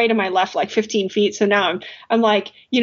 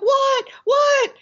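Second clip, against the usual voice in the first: alarmed shout. Retold or imitated. Alarmed reply called up the wall.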